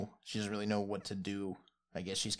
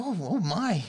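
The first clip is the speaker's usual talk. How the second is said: put-on high startled gasp of a frumpy old woman; startled old lady; 11 semitones higher, 9 dB louder, slower than usual. Old lady startled by strangers, high and loud. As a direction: jump out of your skin as the old gnome lady. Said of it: "she's like".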